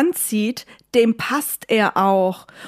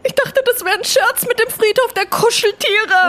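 high, louder scared voice; acted fear; 12 semitones higher, 4 dB louder than usual. Remembering the pet cemetery joke, high scared voice. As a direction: squeak it out in fright.

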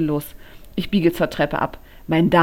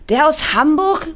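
Silly's voice; 10 semitones higher, 7 dB louder than usual. A narrator's reading. Friend Silly voiced in story.

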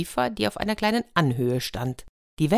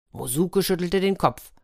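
usual speaking voice

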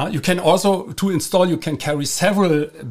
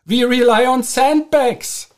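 high, louder mocking declaration; mock Germany's answer; 9 semitones higher, 4 dB louder than usual. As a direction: proclaim it in a raised mock-national voice. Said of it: "what is Germany doing?".

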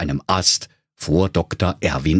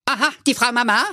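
high, nosy owl voice; curious owl doctor; faster than usual. A narrator's reading. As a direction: ask with sudden curiosity. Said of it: "sagte Doktor Eule neugierig".

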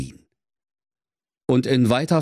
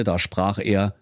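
usual speaking voice

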